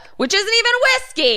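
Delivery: high, fast indignant protest; hammed-up whiskey snob outrage; mock outrage. Acting out outrage over Canadian whiskey.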